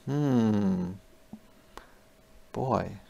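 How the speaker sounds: ordinary delivery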